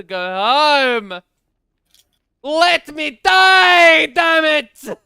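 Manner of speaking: slow wail building to a high yell; desperate shout. Real, not acted. Streamer trapped in game begging to die, desperate shouting. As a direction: stretch the words into a loud, exasperated cry. Begs the game to let him leave.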